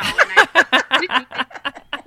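slow words through real laughter; laughing. Real, not acted. Laughing while telling a story.

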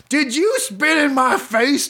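high-pitched mock outrage; hammed-up baseball argument; acted indignant challenge. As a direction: play up the accusation, pitched high. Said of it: "here's how the exchange went".

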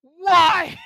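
a loud, high cry of disbelief; exasperated shout. Real, not acted. Fed-up podcaster shouting at the Mario trailer, loud and high. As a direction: throw up your hands and yell it.

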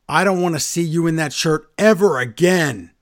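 mock Reigns booming the surprise; mock announcement. Reigns big reveal.

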